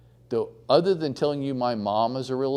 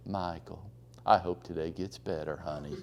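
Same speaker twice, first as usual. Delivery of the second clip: soft sweet voice; 6 semitones lower, 7 dB quieter than usual. Retold or imitated. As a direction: drop your voice and stay sugary calm. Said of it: "she just drops her voice".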